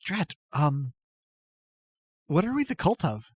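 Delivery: put-on a timid goddess-follower greeting an old friend; timid noble lady.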